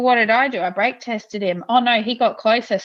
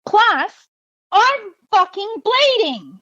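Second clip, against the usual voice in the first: higher, slow, drawn-out exasperation; exasperated retort; 12 semitones higher, slower than usual. Retold or imitated. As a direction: stretch it out high like the last straw. Retold punchline of argument.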